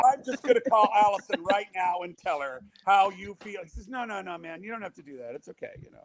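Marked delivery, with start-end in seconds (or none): 0.1-3.6 3 tease him with a gleeful threat; 1 mock teasing threat. 4 speaker teases Mark about calling his wife, gleeful taunt. 3.9-6.0 put-on 2 softer, sheepish drunk mumble; 3 back down quietly, embarrassed; 1 sheepish backpedal. 4 drunk Mark begs him not to call his wife, sheepish.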